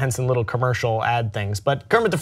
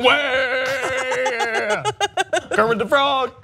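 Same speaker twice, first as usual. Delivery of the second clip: high, laughing frog puppet voice; Kermit voice; 11 semitones higher, much slower than usual. Pretend. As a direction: squeak it out through a laugh like a puppet. Joking about a new Kermit voice.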